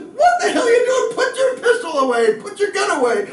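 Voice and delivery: high, loud reprimand shout; cop yelling. Retold or imitated. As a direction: yell at your partner to holster it. Other cop rebukes partner.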